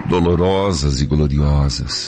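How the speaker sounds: prayer. Acted. Priest leads a repeated prayer.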